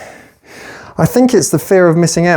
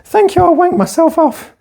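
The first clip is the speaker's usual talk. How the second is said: much higher, sickly-sweet fake thank-you; mock gushing gratitude; 13 semitones higher than usual. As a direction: parody someone fishing for compliments in a high squeal. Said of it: "they're like".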